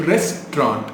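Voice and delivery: ரெஸ்டாரண்ட் as RES-traunt; two syllables. Shown as a demonstration. Teacher modelling the word, two syllables. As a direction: say ரெஸ்டாரண்ட் without the middle vowel. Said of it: "Two syllable".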